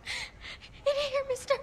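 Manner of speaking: frightened little voice, tearful; a hiding child, afraid to come out; small, teary and scared. Acted.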